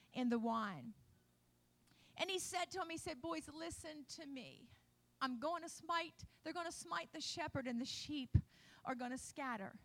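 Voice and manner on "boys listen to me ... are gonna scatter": much softer, intimate and grave; soft warning. Acted. Jesus quietly warning his disciples at supper, soft and grave. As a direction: lean in close, say it low and gentle.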